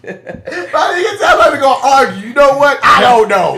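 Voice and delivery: loud high laughing yell; laughing shout. Real, not acted. Co-host mocking the argument, loud laughing shout.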